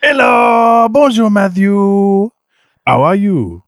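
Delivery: put-on over-the-top French-accented rally star greeting his host; Sebastian Loeb French accent. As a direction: play a boastful Frenchman with a thick French accent. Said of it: "I am Sebastian Loeb".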